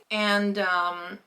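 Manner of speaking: ordinary talk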